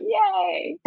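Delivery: high, squeaky little yay; a burst of pretend delight; tiny excited squeal; mock cheer. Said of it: "and be like".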